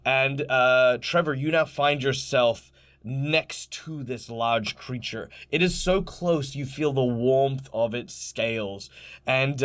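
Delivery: dramatic scene-setting narration, slowing for effect; game master narration. Acted. Game master narrating to the players, in a dramatic storytelling voice.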